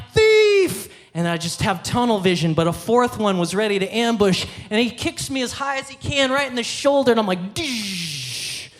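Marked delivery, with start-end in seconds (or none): 0.1-0.9 high, loud re-enacted yell; shouted accusation. Shouting after a thief while chasing. 7.5-8.7 long, softer slow-motion crash sound; imitated impact noise.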